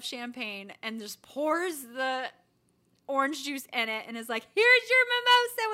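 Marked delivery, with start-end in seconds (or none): 4.5-5.7 much higher and louder cheery server; bubbly waitress. Retold or imitated. Waitress handing mom a champagne bottle, cheery. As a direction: hand it over with a showy flourish.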